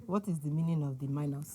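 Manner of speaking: scolding Nigerian mom. Retold or imitated. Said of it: "impression of your mom or auntie".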